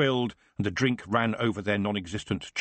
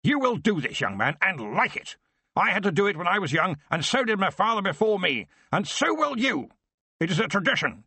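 raging father; 8 semitones higher than usual. Acted. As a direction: berate the boy without pause. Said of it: "he roared".

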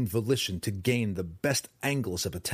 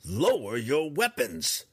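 slower, higher, pointed command; more pointedly; 7 semitones higher, slower than usual. A narrator's reading. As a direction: press each word firmly. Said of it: "more pointedly".

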